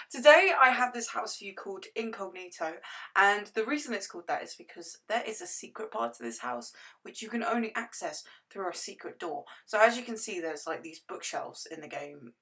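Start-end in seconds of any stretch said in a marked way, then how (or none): none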